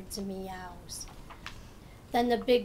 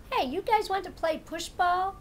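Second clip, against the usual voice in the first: higher, louder eager cat; 8 semitones higher, 4 dB louder than usual. Acted. Cat asks friends to play push ball, eager. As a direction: pitch the game to friends.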